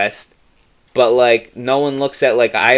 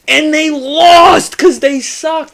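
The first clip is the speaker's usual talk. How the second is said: loud rant; 12 semitones higher, 4 dB louder than usual. Real, not acted.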